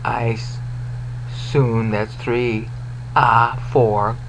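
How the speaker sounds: slow, hushed syllable count; low murmured count of syllables. Shown as a demonstration. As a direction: tick off each syllable quietly. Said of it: "you gotta go five more syllables here".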